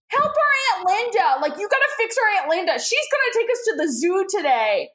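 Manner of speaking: high, urgent kid voice, lightly hammed up; pleading kids. Kids begging the therapist to fix their aunt, mock pleading. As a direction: imitate whiny children begging for help.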